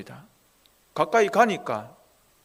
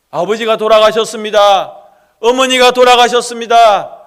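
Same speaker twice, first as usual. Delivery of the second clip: loud, higher wail of loss; anguished cry; 5 semitones higher, 14 dB louder than usual. Acted. Preacher voicing a mourner crying out in loud grief. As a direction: cry out the news of death. Grieving person's cry voiced.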